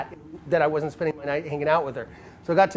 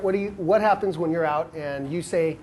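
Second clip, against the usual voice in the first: ordinary talk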